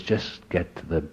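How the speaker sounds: soft, low inner voice nudging him; a hushed voice inside, urging; inner whisper. Retold or imitated. His inner voice quietly urging him to get help. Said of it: "another voice in me went off like a little whisper".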